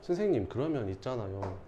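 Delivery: low, soft student question; quietly mimicked student; student asking teacher. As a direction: ask softly, like a student approaching the teacher.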